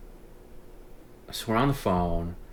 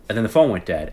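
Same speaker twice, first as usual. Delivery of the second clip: ordinary delivery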